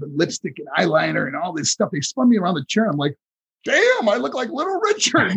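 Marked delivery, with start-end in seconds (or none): 3.6-5.3 high-pitched mock shock; astonished yelp, replayed for laughs; astonished outburst. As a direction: react in hammed-up disbelief. Shocked reaction retold from makeup chair.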